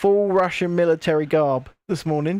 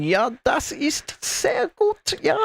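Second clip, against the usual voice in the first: slow, higher-pitched beginner's German; schoolroom German; 7 semitones higher, slower than usual. Shown as a demonstration. Showing off self-taught GCSE German to a friend, slow put-on German accent. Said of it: "I'm teaching myself G C S E German".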